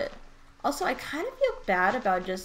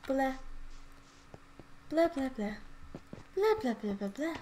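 babbled blahs in short bursts; dazed blahs; 3 semitones higher, 7 dB quieter than usual. Shown as a demonstration. Player showing an overwhelmed state, dazed blahs. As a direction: babble blankly, no idea what to think. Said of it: "Exactly how I am".

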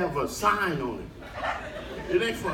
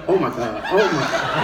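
mock gasp; 8 semitones lower than usual. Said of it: "Have you ever been walking and went".

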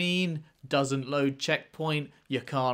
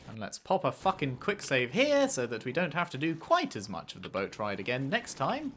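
usual speaking voice

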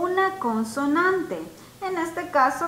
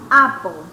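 "Apple" loud and lower, falling from the first vowel; stressed opening vowel; 4 semitones lower, 9 dB louder than usual. Shown as a demonstration. Teacher proves it starts with a vowel, loud stressed "Apple". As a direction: punch the "A" of "Apple". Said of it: "Es una vocal".

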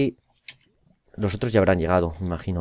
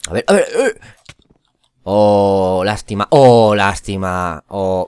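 loud excited groan; 8 dB louder, much slower than usual. Real, not acted. Streamer watching the beast, loud excited near-miss reaction. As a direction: react as the beast almost dies.

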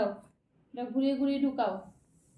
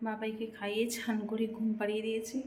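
hushed talking; 9 dB quieter than usual. Real, not acted. Mother vlogging while her child sleeps, hushed talking. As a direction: keep it down so the sleeper stays asleep. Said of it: "খুব আস্তে আস্তে কথা বলতে হচ্ছে".

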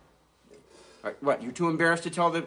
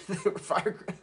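laughing; 7 semitones higher than usual. Real, not acted. Laughing repeat.

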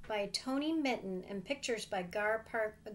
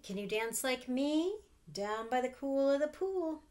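put-on reading to children, building; 3 semitones higher, 8 dB louder than usual. A narrator's reading. Aunt reading to the kids, growing louder and livelier. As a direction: let the excitement build line by line. Aunt reads picture book aloud.

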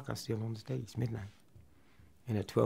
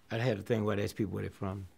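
usual speaking voice

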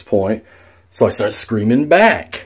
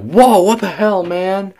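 acted-out startled reaction, much higher; startled outburst; 10 semitones higher than usual. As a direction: jump at a noise in a high voice. Reacts to noises, quoting himself.

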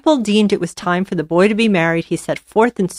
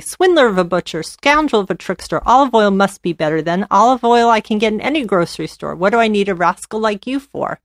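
ordinary delivery

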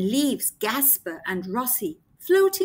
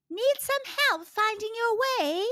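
bird voice; 9 semitones higher than usual. Acted. Snow petrel voiced.

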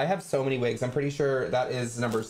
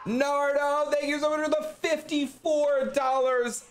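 excited thanks; 11 semitones higher, slower than usual. Real, not acted. Thanking donor on stream.

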